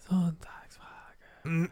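slow whispered announcer call; monster truck ad. Pretend. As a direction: whisper it slowly like a hype ad. Jingle for the Sunday question.

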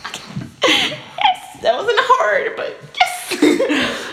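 high, laughing celebration; gleeful triumph. Retold or imitated. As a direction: squeal it through laughter, stretching it out. Replaying glee at her yes.

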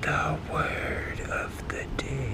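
slow dramatic whisper. Acted. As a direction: whisper it like a secret. Whispered segment intro again.

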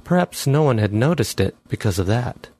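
ordinary delivery, nothing marked

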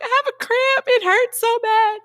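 very high, hammed-up wail of complaint; mock distress, stretched at the end; dramatic freak-out. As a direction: replay the pain as a high-pitched whine. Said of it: "she was freaking out".

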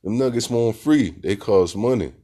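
hammed-up complaint about wasted money; mock rant about money. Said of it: "I said".

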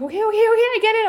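high, loud, rapid-fire giving in; exasperated retort. Retold or imitated. Answering the voice yelling at her, loud exasperation. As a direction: fire it back fast at a nagging voice.

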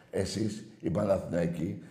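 normal talking voice

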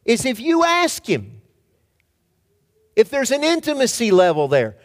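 high, loud, driving preacher's cry; preaching at full force. Acted. Pastor pressing the congregation, full-force preaching. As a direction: press the point hard on the congregation.

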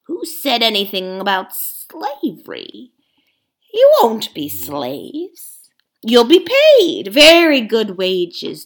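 loud, pushy ape lecturing the beasts; a sly ape bullying the crowd; scheming ape. Acted. Ape haranguing the gathered beasts, loud and pushy.

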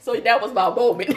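ordinary delivery

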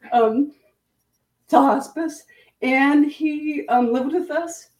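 fighting back tears mid-sentence; teary. Real, not acted. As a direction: voice high and choked with tears. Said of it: "sorry, might get crying".

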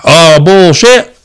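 slow dismissive scoff, a light take on the uncle; impression of uncle. Uncle Brad brushing off the family's warning, a slow loud scoff. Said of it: "I do a pretty good impression of him".

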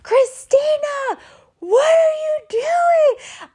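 drawn-out, high-pitched mock yell. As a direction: holler after someone running away, stretching the words. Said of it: "starts yelling".

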